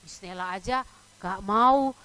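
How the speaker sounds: ordinary delivery, nothing marked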